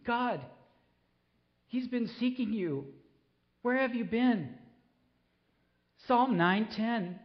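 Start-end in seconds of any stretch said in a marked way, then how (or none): 0.0-4.6 high, pleading lament to God; a father's raw plea, voice pitched up; anguished cry. Retold or imitated. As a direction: cry out to God for your son.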